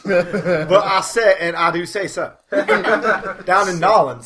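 slow Louisiana gentleman drawl; southern drawl. Pretend. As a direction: drawl it out like a New Orleans gent. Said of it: "Down in Nolans".

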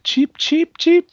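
high squeaky bird chirps; parakeet chatter. Retold or imitated. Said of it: "it's more of a parakeet impression".

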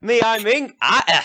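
high, brash country villager; rough villager. Acted. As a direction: greet travellers as a nosy local. Villager Ian introduces himself.

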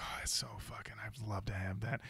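hushed envious whisper; a longing whisper. Retold or imitated. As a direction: whisper it like you crave it. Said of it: "I was like".

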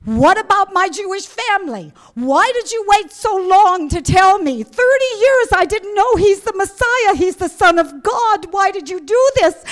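high, loud, anguished crying out; screaming out to God. Retold or imitated. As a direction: wail your protest up at God, re-enacted. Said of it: "screaming out, crying to God".

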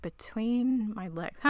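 mildly acted bewilderment; imitated confusion. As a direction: repeat it slowly, as if baffled. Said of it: "she could not be more confused".

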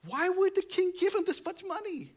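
very high, squeaky mock question; hammed-up falsetto disbelief; incredulous disciples. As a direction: imitate baffled listeners way up high. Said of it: "they'd be like".

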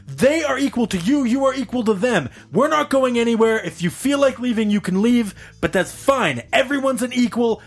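high-pitched, impassioned protest, imitated; mock-heroic rant pitched high throughout; indignant defending speech. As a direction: re-enact a bystander lecturing a bully. Re-enacts bystander's indignant defending speech.

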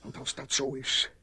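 ordinary delivery

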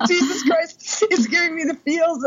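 high, laughing half-cry; fake-weep through a laugh; mock teary. Seeing the bridesmaid dress photos, mock teary and high. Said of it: "I'm, like, crying about".

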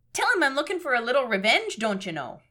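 singsong Minnesotan, falling at the end; folksy Fargo-style Minnesota voice; Minnesota accent. Acted. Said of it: "supposed to be doing a Minnesota voice".

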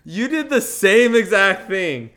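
loud, high indignant accusation; acted-out indignant protest; retold outrage. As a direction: throw it at him, loud and incredulous. Confronting dad in retold quarrel.

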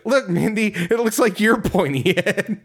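high, sing-song jeer at his sister; mocking taunt. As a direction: poke fun in a high mocking voice. Taunting sister about pointy head.

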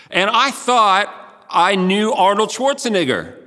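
normal talking voice

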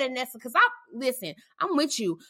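normal talking voice

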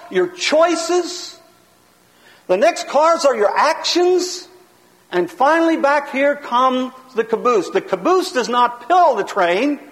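ordinary talk

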